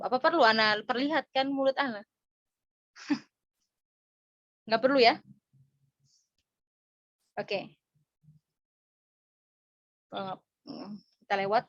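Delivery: normal talking voice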